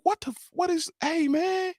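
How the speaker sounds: very high, taken-aback protest; acted-out bewilderment, voice shooting up; shocked reaction. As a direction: squeal it in mock disbelief. Acts his shocked reaction to song.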